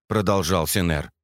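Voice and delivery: usual speaking voice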